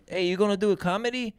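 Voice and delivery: high voice in a badly imitated accent; hammed-up foreign accent; Alejandro. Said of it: "just doing a horrendous accent".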